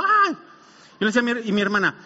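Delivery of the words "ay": high, sudden cry of fright; hammed-up little shriek; mock startled yelp. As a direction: act out jumping at a shadow. Acts his startled jump at shadow.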